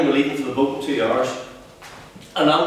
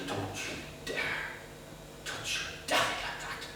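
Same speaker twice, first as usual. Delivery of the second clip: breathless whispered plea; mother begging son to stop, hushed; urgent whisper; 16 dB quieter than usual. Acted. Mother pleading in distress.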